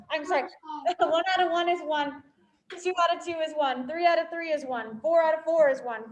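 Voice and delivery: ordinary delivery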